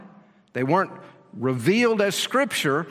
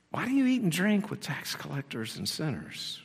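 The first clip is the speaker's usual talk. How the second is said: softer, muttered grumbling; mock grumbling mutter; 8 dB quieter than usual. Pharisees grumbling at the disciples, softly muttered. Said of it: "to mutter, to whisper".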